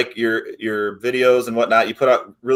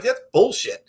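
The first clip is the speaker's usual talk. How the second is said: mock dismissive executive; 8 semitones higher, slower than usual. Voicing the executive's blunt reaction.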